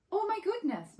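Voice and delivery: high, loud startled gasp; exclaiming. Acted. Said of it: "Mole exclaimed".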